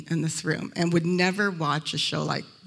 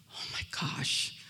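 hushed, awed whisper; breathless whisper; 10 dB quieter, slower than usual. Retold or imitated. Woman seeing the best looking man, awed whisper. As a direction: gasp it under your breath, smitten.

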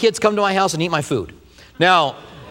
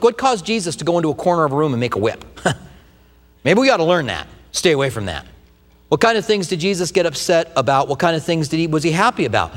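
ordinary talk, nothing marked

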